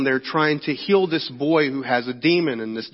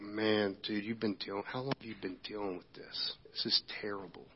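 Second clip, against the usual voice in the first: soft, nearly breathless compassion, fading into a whisper; hushed pity; 6 semitones lower, 17 dB quieter than usual. Retold or imitated. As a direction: lean in and murmur it as if to a grieving father. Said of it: "there's this moment where he's just like".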